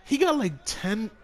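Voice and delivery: high loud disbelieving shriek; shocked yell. Real, not acted. Shocked at game animation.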